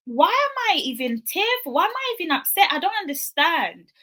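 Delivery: high-pitched, baffled protest; bewildered self-questioning. Retold or imitated. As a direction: question yourself in rising bewilderment. Retells tearful bewilderment at herself.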